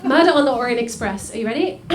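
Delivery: ordinary delivery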